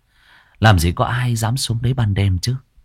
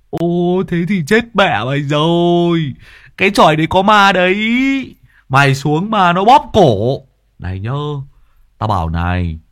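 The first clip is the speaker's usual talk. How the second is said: higher and louder ghost tale; spooky scaremongering; 6 semitones higher, 4 dB louder than usual. Acted. Friend scaring Dần about the haunted hut, loud. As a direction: scare him loud and high.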